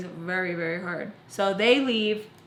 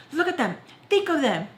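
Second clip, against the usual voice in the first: higher-pitched imitation of a mother's reproach; scolding mom; 7 semitones higher than usual. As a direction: act out mom pointing at the kids. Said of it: "my mom just like".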